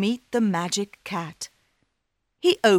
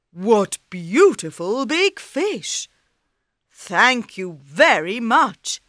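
king voice; 3 semitones higher, 6 dB louder than usual. Acted. King thanks cat.

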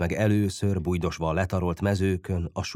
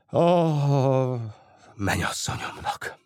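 heavy sigh; 5 semitones higher than usual. A narrator's reading. Lovesick boy confessing to his friend, sighing. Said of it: "Bütyök akkorát sóhajtott".

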